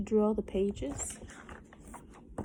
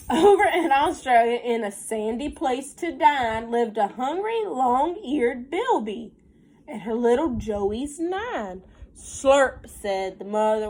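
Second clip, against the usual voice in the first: lilting storybook read, slowed on the rhymes; reading to children; 3 semitones higher, 5 dB louder, slower than usual. Acted. Teacher reading a counting rhyme to her class, sing-song storybook voice. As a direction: read the rhyme aloud to the little ones. Reads picture book aloud.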